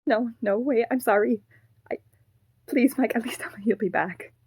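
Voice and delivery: pleading. Acted. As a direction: beg them not to walk away. Puppet begs Mike not to leave.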